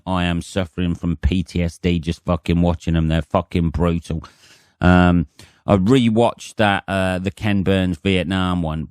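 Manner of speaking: normal talking voice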